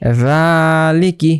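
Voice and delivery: রালিকি drawn out slowly, the long a held one beat; one alif stretch. Shown as a demonstration. Teacher showing the class how to read রালিকি slowly. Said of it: "কারণ উপরে মদের হরকত আছে".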